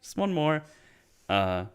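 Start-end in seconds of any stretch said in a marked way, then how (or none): 0.0-0.6 mock pleading, much higher and louder, fading down by the end; Ric Flair begging. Aging wrestler begging for one more match, mock plea fading. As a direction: beg for one last comeback, then trail off. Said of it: "Ric Flair retired, like, seventeen thousand times".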